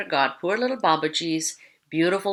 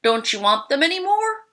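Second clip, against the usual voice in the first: calling out; 11 semitones higher, 4 dB louder than usual. A narrator's reading. Little Babaji calls to the distant tigers, calling out. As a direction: call out boldly, much higher than narration. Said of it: "jumped up and called out".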